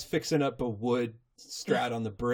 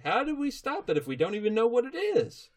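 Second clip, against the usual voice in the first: higher questioning voice; Applejack; 9 semitones higher than usual. Retold or imitated. Applejack to Twilight, lightly voiced. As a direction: ask it practically. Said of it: "Applejack's just like".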